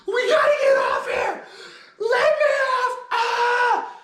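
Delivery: very high, loud hysterical shriek; panicked freak-out. Acted. Girl with a premonition on the roller coaster, shrieking in panic. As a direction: scream it at the top of your range in panic. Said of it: "This chick starts freaking out".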